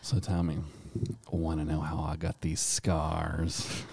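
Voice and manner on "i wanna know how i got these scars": Joker quote. Retold or imitated. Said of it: "specifically Heath Ledger's Joker".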